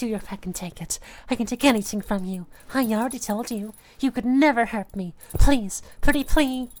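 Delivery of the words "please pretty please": loud pleading. Acted. Begging in roleplay.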